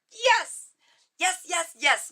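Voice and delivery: loud, high, delighted yelps; thrilled yes. Real, not acted. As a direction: cheer the card like you just won. Thrilled by the tarot card.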